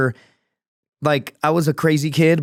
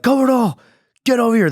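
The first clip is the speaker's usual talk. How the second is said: high, louder call across a camp; mock-hail a buddy over; 11 semitones higher, 3 dB louder than usual. Coworkers calling him over, mock high shout.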